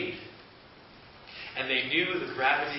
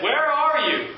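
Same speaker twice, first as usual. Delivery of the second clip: much higher and louder call; God calling for Adam, higher and louder; 12 semitones higher, 9 dB louder than usual. Acted. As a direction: call across the garden to find them. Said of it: "He said".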